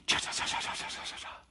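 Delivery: rapid unvoiced cha-cha sounds; hushed stream of hissy syllables; whispered chattering noise. Shown as a demonstration. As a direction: imitate a thought creeping in. Imitates intruding thought.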